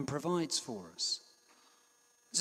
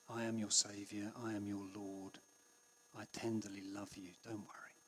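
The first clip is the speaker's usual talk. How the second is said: tender reassurance; 5 semitones lower, 9 dB quieter than usual. Retold or imitated. Jesus comforting a worried listener, tender and soft. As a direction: whisper it like holding a lamb close. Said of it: "I think that's the tone of voice".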